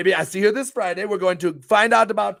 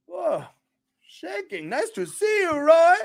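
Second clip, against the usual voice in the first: high greeting; 9 semitones higher, slower than usual. Real, not acted. Greeting viewer Roy.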